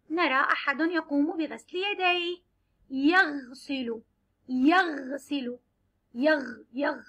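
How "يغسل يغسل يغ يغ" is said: يغسل drawn out slowly; slow sounding out. Shown as a demonstration. Teacher to children, drawing out يغسل slowly. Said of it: "نختار حرف الغين مع السكون".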